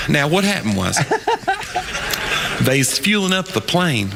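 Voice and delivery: slow, drawn-out Southern pilot impression; thick Southern accent. Comic as pilot explaining delay, thick Southern accent. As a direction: imitate a folksy Southern captain, taking his time. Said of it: "he had the thickest Southern accent".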